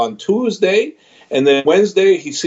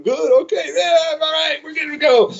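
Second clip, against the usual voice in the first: much higher, light relieved voice; 13 semitones higher than usual. Retold or imitated. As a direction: lift it way up, relieved and easy. Coach retells relief after sparring.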